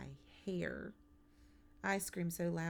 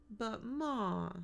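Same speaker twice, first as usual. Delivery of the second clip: higher-pitched protesting whine; whining; 6 semitones higher, 3 dB louder than usual. A narrator's reading. Son objects to his nap, whining. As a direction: whine it at Mom, stretching the last word. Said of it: "Kermit whines".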